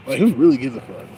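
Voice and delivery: who really gives a fuck, loud and pushed. Real, not acted. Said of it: "repeated line".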